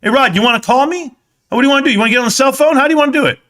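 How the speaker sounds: heated dare to a rival streamer; heated taunt. Real, not acted. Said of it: "I woke up heated".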